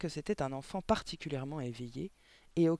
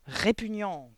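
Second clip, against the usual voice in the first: loud, higher one-word outburst; vociferated disgust; 5 semitones higher, 7 dB louder, much slower than usual. A narrator's reading. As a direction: shout it in revulsion. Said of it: "vociféra mademoiselle Le Gourdin". (vocifération dégoûtée)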